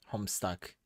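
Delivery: ordinary talk, nothing marked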